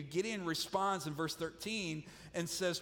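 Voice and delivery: ordinary talk, nothing marked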